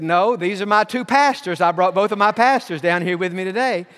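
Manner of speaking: mom's firm, loud no; emphatic reply. Retold or imitated. Mom telling the nurse she has her pastors, firm and loud. Mom firmly refusing a chaplain.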